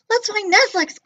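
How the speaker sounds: high loud eager cry. Acted. Bork crying out the plan, high and loud. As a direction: burst out with the idea. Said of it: "cried Bork".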